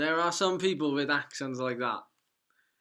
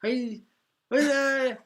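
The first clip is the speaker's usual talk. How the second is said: put-on North Welsh accent; 10 semitones higher, 4 dB louder, slower than usual. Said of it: "first time I'd ever heard a North Walian speak".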